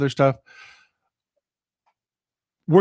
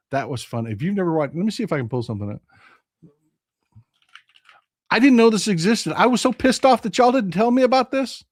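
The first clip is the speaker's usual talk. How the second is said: usual speaking voice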